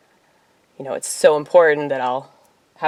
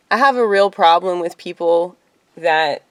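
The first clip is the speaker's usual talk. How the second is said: ordinary talk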